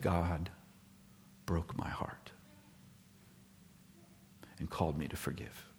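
Paisley confessing God broke his heart, hushed; hushed confession. Retold or imitated. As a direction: breathe it out like a broken man. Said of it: "Because he said".

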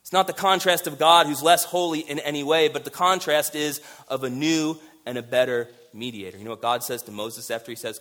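ordinary delivery

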